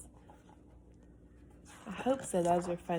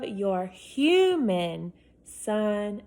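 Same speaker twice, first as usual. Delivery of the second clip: human stretched out slow and louder; stress on human; 6 dB louder, much slower than usual. Acted. Boy signing his letter, human slow and punched. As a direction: lean hard on human. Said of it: "He put human in all letters to emphasize".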